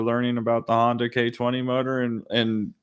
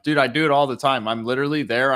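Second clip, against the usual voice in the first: normal talking voice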